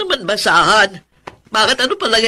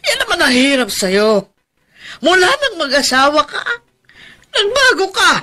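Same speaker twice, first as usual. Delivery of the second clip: crying; 5 semitones higher than usual. Acted. Aunt crying, reproaching Tony.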